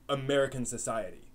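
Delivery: ordinary talk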